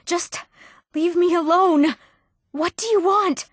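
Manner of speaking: high-pitched, distressed plea; alarmed pleading. Acted. Begging an attacker to leave her alone, alarmed. Threatened character pleads to be left.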